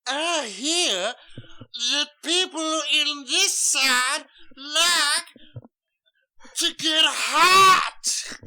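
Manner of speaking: high, loud hammed-up rock frontman, stretched slowly; over-the-top imitation of stage patter. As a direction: belt it like a cheesy arena rock singer. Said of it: "He's like".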